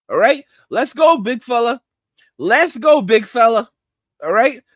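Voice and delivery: ordinary talk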